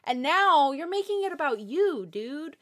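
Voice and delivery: incredulous mock reprimand; mock scolding. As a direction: tell off a fictional guy, indignant. Scolding the character directly.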